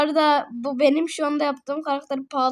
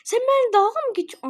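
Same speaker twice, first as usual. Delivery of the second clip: high, quick burst of disbelief; fast, high-pitched exasperation; indignant protest; 8 semitones higher, faster than usual. Real, not acted. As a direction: protest as if being made a fool of. Protests at being messed with.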